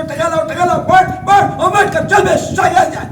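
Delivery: loud, high mock scolding of a child; hammed-up nagging mother yelling at her kid; nagging parent. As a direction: bark it like a pushy parent.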